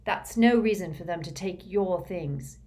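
usual speaking voice

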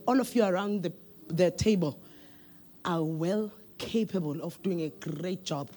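soft, low, kind manager voice; gently relieve a tired employee of the work; gentle boss. Acted. Manager at the boardroom table softly reassures an overworked employee. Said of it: "And then you say".

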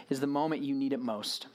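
ordinary talk, nothing marked

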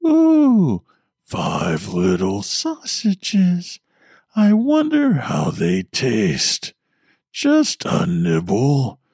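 mock the victim, sliding between child and demon; mocking girl-demon voice. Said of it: "the voice mocked, going back and forth".

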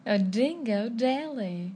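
slow, bad Australian accent. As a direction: try a hammy Australian accent. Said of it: "Am I saying a good Australian accent?".

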